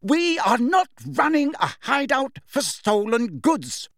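very high, slow, outraged yelling; furious shout. A narrator's reading. Mother shouting downstairs about stolen goods, furious shout. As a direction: yell every word like a scolding mother, drawn out. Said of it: "shouting at the top of her voice".

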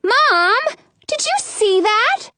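put-on high-pitched indignant child; girl calling out. A narrator's reading. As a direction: complain to mom in a high voice. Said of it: "Bree calls out".

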